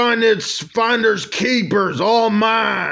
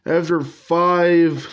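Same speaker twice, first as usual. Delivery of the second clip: usual speaking voice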